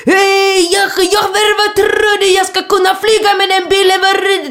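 very high, loud imitation of a screaming driver; hammed-up film-style yelling rant. Angry driver at stressed passenger, mock screaming. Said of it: "Du vet, alltså skrik".

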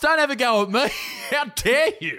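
high laughing mock telling-off; mock outrage. Mock outraged passenger laughing at the captain. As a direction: pretend to be offended at the captain, laughing.

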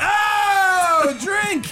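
high shouted call to drink; loud call. Real, not acted. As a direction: yell it like a game call. Calling out drinking word.

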